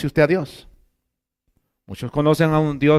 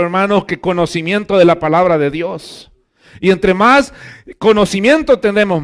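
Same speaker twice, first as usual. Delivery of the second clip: loud, higher preaching, slowing to hammer home omnisciente; full-force preaching; 6 semitones higher, 6 dB louder than usual. Acted. Pastor insisting to the congregation that God knows them, loud preaching. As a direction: proclaim it to the whole church.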